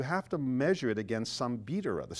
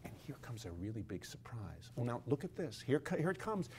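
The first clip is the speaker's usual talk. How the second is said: hushed docent; 5 dB quieter than usual. Retold or imitated. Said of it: "he would go like".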